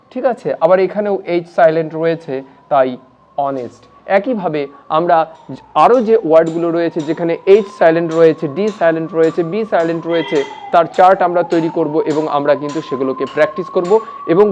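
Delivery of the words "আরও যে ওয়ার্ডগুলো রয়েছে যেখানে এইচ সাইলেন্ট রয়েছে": ordinary talk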